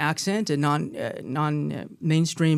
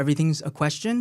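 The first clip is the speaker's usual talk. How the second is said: EVERYTHING'S A QUESTION with a rise at the end, as if asking; uptalk; 2 semitones lower, 5 dB quieter, faster than usual. Shown as a demonstration. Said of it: "the high rising terminal or the uptalk".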